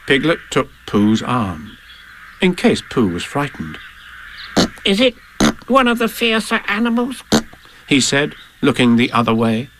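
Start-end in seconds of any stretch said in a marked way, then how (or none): 4.8-7.2 high, anxious little creature; nervous squeaky character; Piglet's voice. Acted. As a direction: ask timidly in a small high voice. Frightened Piglet asks Pooh.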